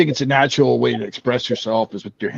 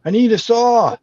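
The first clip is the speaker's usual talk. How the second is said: calling up to helper; 10 semitones higher, slower than usual. Acted. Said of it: "he goes".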